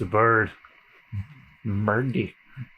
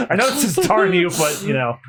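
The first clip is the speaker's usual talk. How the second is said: ordinary delivery, nothing marked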